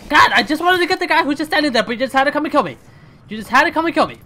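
high, loud exasperated complaint; frustrated rant. Real, not acted. Gamer ranting at the player who killed him.

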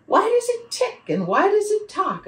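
high, loud volley of questions; insistent high-pitched little questioner; child's eager questioning. A narrator's reading. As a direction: pipe up high like an inquisitive child. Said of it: "simply asked".

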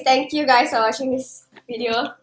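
ordinary talk, nothing marked

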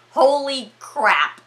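slow, loud, emphatic disbelief; stunned exclamation. Real, not acted. Storyteller reacts to the film's climax, emphatically stunned.

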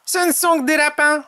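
high-pitched, carefree character voice; cheerful Tom. A narrator's reading. Tom dismisses the harmless rabbits, high cheerful voice. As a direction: shrug it off with a grin, pitched high. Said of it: "dit Tom avec un grand sourire".

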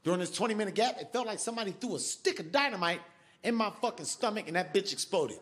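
ordinary delivery

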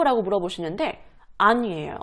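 ordinary talk, nothing marked

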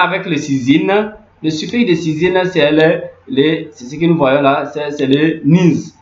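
usual speaking voice

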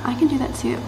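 normal talking voice

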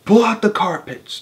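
high-pitched shouted command; shouting. A narrator's reading. Mugglewump orders the carpet pulled out, shouting. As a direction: launch the work with a shout. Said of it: "shouted Mugglewump".

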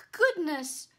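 high, loud flustered thanks; spluttering knight. Acted. Knight thanking his rescuers, flustered. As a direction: stammer out your relief. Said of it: "spluttered the knight".

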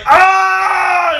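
high, loud, long scream; mock scream. Host acts out a wrestler with broken glass, high mock scream. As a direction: scream out as if attacking in a brawl. Retelling a wrestler's reaction in brawl.

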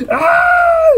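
very high shriek; excited scream. Real, not acted. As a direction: let out an overexcited squeal. Overexcited urge to touch game figure.

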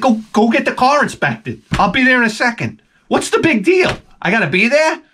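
mildly exasperated what's-the-big-deal; exasperated retort. Retold or imitated. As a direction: wave off his nagging wife. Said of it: "I said".

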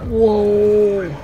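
high, drawn-out startled howl; alarmed cry. Real, not acted. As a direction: let out a long cry of alarm. Alarmed by event in game.

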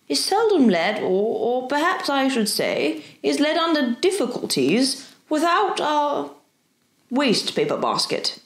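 halting build, then a drop on the last words; hesitant professor. Acted. Professor venturing a darling wish, hesitant.

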